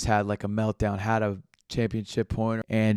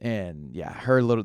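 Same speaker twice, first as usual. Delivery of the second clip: usual speaking voice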